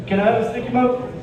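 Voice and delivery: put-on a goofy kid blurting it out; kid's voice. Kid voiced in classroom story.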